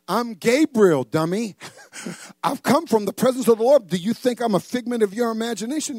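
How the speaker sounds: loud, high mock indignation; hammed-up offended rebuke; indignant angel. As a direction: scold like an affronted archangel. Offended angel scolds Zechariah.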